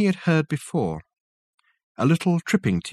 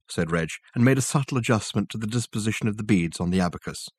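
usual speaking voice

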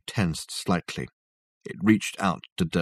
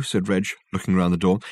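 usual speaking voice

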